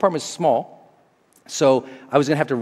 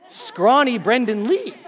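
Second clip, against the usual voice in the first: laughing; 7 semitones higher, slower than usual. Real, not acted. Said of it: "Me, laugh".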